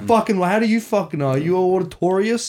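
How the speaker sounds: high-pitched, indignant disbelief; exasperated retold outburst; incredulous protest. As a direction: challenge him, voice jumping up high. Acted incredulous outburst at instructor.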